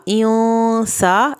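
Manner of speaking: इयों held long through the nose before सा; slow recitation with ghunna on इयों; held nasal sound. Shown as a demonstration. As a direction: linger on the nasal of इयों, very slowly. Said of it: "गुन्ना जब आप करेंगे, थोड़ी सी देर लगानी है".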